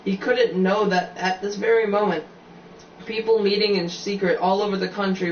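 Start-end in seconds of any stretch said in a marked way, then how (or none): none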